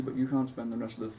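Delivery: ordinary talk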